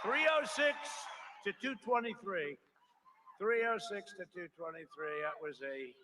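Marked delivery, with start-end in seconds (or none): 0.0-2.6 triumphant shout. Real, not acted. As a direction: proclaim the win to the crowd. Crowd-rousing result call.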